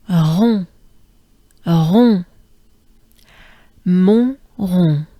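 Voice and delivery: rond with a breathy throat R on the exhale; French R. Shown as a demonstration. Teacher demonstrating the French R loudly. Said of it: "exhale to pronounce the R".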